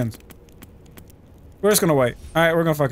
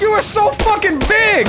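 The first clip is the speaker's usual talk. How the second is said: panicked shout; 11 semitones higher than usual. Real, not acted. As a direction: shout at the huge animal. Cougar attack.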